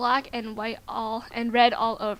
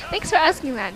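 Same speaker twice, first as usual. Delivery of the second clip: ordinary delivery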